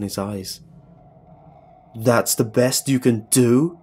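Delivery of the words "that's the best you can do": loud, slow challenge, rising at the end; drawn-out cocky question; defiant taunt. Acted. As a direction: throw it back at your attacker. Beaten hero taunts his attacker.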